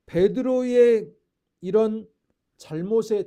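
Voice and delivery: loud, very slow, drawn out; preaching emphasis. Real, not acted. Preacher stressing Peter's fault to the congregation, loud and slow. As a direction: hammer each word home.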